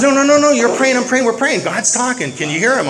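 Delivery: high, loud, urgent retort; insistent interruption. Retold or imitated. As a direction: cut in excitedly, imitating an insistent believer. Acts out friend's insistent interruption.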